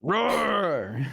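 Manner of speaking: high playful mock roar; dinosaur voice. Mock roar at each other.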